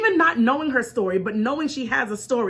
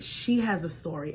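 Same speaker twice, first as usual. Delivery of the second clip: quiet inner voice; 7 semitones lower, 8 dB quieter than usual. Retold or imitated. Inner voice speaking.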